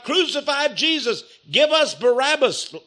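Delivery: high shouted crowd chant; mob crying out. Acted. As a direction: cry out like an angry mob. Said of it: "they were all crying out".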